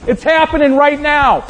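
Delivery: high, loud, thrilled nudging of a friend; tapping people aside at the resurrection, high excited voice. Pretend. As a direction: burst with excitement, tapping someone's shoulder. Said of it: "Be like".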